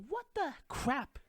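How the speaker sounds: high mock outrage. Mocking reaction to media.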